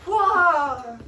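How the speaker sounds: loud, drawn-out wah; mock fight yelp; cartoonish dodge holler; dodging cry. Voicing a dodge.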